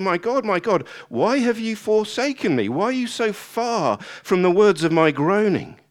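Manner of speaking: loud, high psalm lament; faith-filled complaint. Acted. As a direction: cry out to God in lament. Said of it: "complaining to God with faith and submission".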